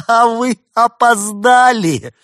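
high, loud, laughed-out words; slow, hysterical high-pitched crowing; shaking with laughter. A narrator's reading. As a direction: burst out laughing and squeal it through the laughter. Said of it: "продолжая трястись от смеха".

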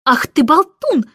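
cross nanny telling off the bird; angry rebuke. A narrator's reading. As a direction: snap at the chatterbox. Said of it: "рассердилась Мэри Поппинс".

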